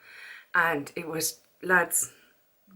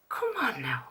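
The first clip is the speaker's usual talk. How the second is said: whispered exasperation; 2 semitones lower, 7 dB quieter than usual. Real, not acted. Exasperated aside while ranting.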